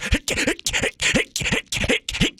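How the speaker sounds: breathy strained grunts; gasping against the g's; under heavy g force. Retold or imitated.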